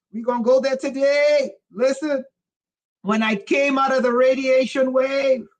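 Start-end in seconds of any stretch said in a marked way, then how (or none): none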